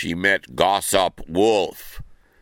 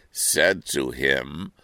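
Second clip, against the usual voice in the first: ordinary delivery, nothing marked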